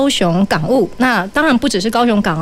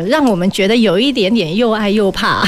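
usual speaking voice

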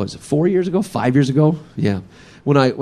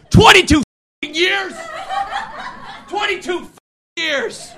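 very high, strained mock outrage; outraged disbelief. Brother rises from dinner table, high outraged squeal.

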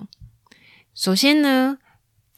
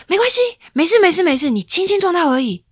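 loud reassurance; 10 semitones higher, 6 dB louder, faster than usual. Retold or imitated. Grandma's words rendered in Chinese.

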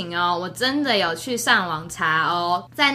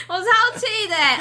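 mock anger; 10 semitones higher than usual.

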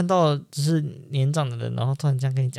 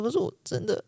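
mock choked-up start; 9 semitones higher, 5 dB quieter than usual. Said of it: "我就真的讲了一半".

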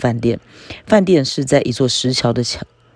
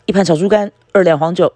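higher, louder call to the waiter; calling out an order; 6 semitones higher, 4 dB louder than usual. A narrator's reading. Genlong shouts his order to the waiter, higher and louder. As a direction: shout the order across the room. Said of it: "根龙也喊道".

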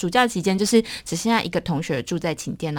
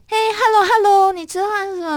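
cheery greeting voice; 13 semitones higher, 5 dB louder than usual. Retold or imitated. Roommate's cheery greeting imitated.

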